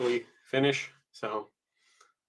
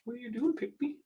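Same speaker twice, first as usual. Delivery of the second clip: pet voice; 10 semitones higher, 11 dB quieter than usual. Real, not acted. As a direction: sweet-talk the little dog. Talking to the pet.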